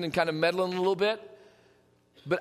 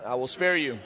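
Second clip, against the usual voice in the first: ordinary delivery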